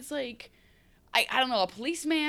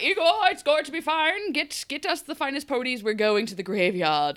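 higher, louder mock accent for Dr. Whale; hammed-up affected accent, sliding off target; odd unplaceable accent; 6 semitones higher, 4 dB louder than usual. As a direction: put on a strange accent of no clear origin. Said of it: "I don't know what accent that was".

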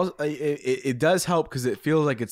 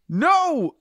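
high, loud anguished shout; 5 dB louder than usual. A narrator's reading. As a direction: scream it out in anguish. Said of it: "Watcher shouted".

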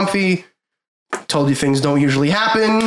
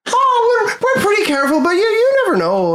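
high-pitched ship captain sliding into a light Irish lilt; high, slightly Irish captain; 13 semitones higher than usual. Acted. Game master voicing the captain to the party, high and a little Irish. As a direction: play the cheerful captain high with a hint of Irish. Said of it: "high, and then it sorta slipped into, like, a little Irishy".

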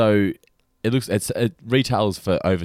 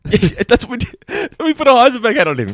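high, laughing through the words; can't stop giggling while telling it; 12 semitones higher, 6 dB louder than usual. Real, not acted.

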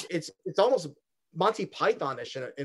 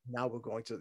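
soft, low, dead-flat radio voice; low deadpan; 6 semitones lower, 12 dB quieter than usual. Retold or imitated. Imitating the skit hosts in a soft deadpan. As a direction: drop to a soft deadpan murmur. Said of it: "very low and deadpan".

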